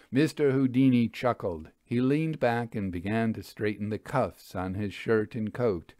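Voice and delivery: plain reading voice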